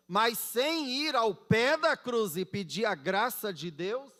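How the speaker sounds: fervent preacher at full volume; emphatic preaching. Real, not acted. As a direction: proclaim it forcefully, phrase by phrase.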